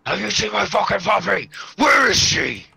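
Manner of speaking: high furious yell; shouting. Pretend. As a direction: scream it in rage. Escalating puppy bit.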